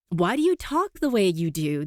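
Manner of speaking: ordinary talk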